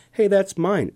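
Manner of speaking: high-pitched storybook yell; mild yell. A narrator's reading. Ant protesting over the chair, mild high yell. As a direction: call out high, without full volume. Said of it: "Oscar yelled".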